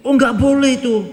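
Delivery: high, fussy forbidding; mock a worried parent saying no; overprotective parent. Teacher mimics a parent forbidding the trip, high and fussy. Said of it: "orang tua yang anaknya diproteksi".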